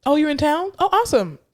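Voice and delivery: high, chirpy mock grandmother; hammed-up carefree grandmother; breezy grandma. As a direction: toss off the visit cheerfully and leave. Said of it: "she's just like".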